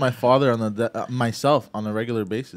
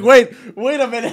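high, loud laughing protest; imitated interjection; 10 semitones higher, 8 dB louder than usual. Teasing friend's reaction, high mock alarm. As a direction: break in as if baffled. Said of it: "You're like".

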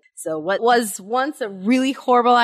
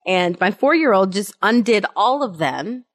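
normal talking voice

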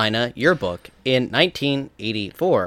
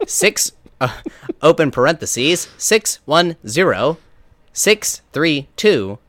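robot voice; 3 semitones higher than usual. Pretend. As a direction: recite digits like a phone bot. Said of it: "You do a better robot than I do".

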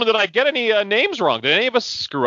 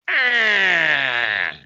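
put-on disgusted old man groan; 6 semitones higher than usual. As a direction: grumble it off. End of old man rant.

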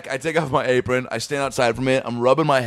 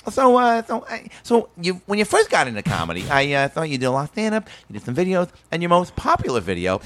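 high-pitched talk-show host voice; Jay Leno impression; 5 semitones higher than usual. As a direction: imitate a late-night host stumbling into his question. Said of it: "Leno's gonna be like".

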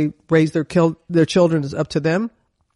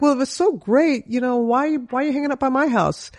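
mock kid's question; 6 semitones higher than usual. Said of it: "I'm thinking like".

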